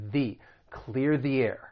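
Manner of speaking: THE said "thee" in "clear the air"; slow model phrase, ee vowel in THE. Shown as a demonstration. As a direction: carefully link "thee" into air. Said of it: "you are going to say".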